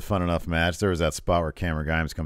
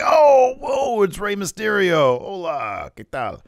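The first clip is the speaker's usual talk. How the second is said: high, loud starstruck gush; a fan meeting his hero; fanboying; 7 dB louder, slower than usual. Retold or imitated. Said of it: "He starts fanboying".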